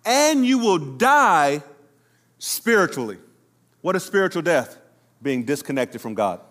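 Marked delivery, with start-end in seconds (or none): 0.1-3.2 slow solemn build. Shown as a demonstration. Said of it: "He's literally saying".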